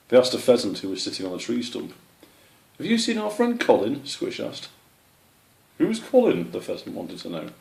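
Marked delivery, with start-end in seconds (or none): 2.8-4.1 high, eager little squirrel voice; squeaky young squirrel. Acted. Young squirrel asks a pheasant about his friend, high and eager. 5.8-6.5 high, blank bird voice; puzzled pheasant. Acted. Pheasant puzzled by the squirrel's question, high voice.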